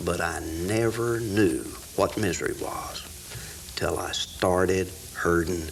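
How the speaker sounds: reciting a rhyming poem with rising energy; poem recitation. Acted. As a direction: build each line like a campfire recital.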